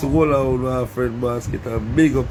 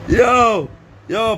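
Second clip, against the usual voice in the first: higher, louder yo; excited call; 6 semitones higher, 4 dB louder than usual. Real, not acted. Vlogger calling out to his people after laughing, louder and higher. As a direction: burst out with yo.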